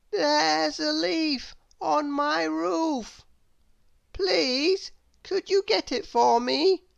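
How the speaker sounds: high, slow, fretful little man; Mister Worry voice. A narrator's reading. Mister Worry begging Little Miss Somersault from below, high and slow. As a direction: plead up high and slow like a worrier. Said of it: "he asked".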